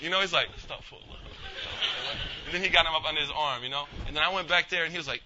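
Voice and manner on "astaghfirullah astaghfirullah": breath-only pious mutter; imitating a furtive whispered prayer; whispered repenting. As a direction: whisper it as if to yourself. Imitates brother murmuring repentance.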